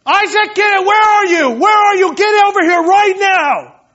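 put-on loud, high, exasperated shouting at a kid; frustrated parent yelling. Frustrated dad shouting for his son Isaac, furious. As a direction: yell at your kids like you've lost patience. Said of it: "I'm very frustrated".

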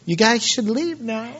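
meek, wimpy request; weak little dude. Acted. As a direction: ask it like a pushover. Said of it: "just a little weak dude that's just like".

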